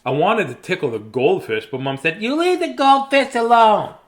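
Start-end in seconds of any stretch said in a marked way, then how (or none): 2.2-4.0 high, loud mom holler; scolding mom. Acted. Mom defending goldfish from tickling child, scolding holler. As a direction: holler the warning as mom, pitched way up.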